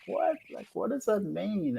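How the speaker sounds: high-pitched, soft baffled question; confused. Retold or imitated. Newcomer to Australia baffled by indirect talk, acting confused. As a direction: re-enact being puzzled, voice jumping up. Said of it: "I was really confused".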